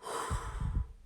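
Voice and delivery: long, slow breath out; calming exhale. Shown as a demonstration. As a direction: demonstrate a slow breath to settle the heart. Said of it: "slow their heart rate down".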